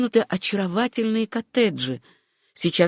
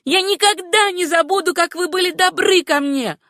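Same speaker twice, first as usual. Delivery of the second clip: very high, ringing call of thanks; ringing shout; 11 semitones higher, 3 dB louder than usual. A narrator's reading. Susanna calls thanks back to the baker, high ringing shout. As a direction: call out gratefully in a high, clear voice. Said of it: "крикнула звонким голосом".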